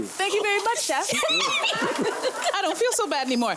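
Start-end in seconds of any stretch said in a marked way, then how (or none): 1.1-2.5 high-pitched giggle. Real, not acted. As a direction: giggle with embarrassment. Laughs after thanking the chef.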